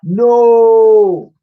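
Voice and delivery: very loud, very high shout. A narrator's reading. Twins shouting as slime floods the shop. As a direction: yell it in horror. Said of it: "shouted the pair".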